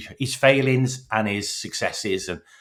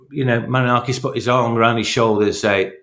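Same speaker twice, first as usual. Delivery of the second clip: ordinary talk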